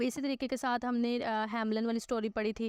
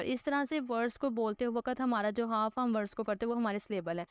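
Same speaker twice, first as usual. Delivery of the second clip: normal talking voice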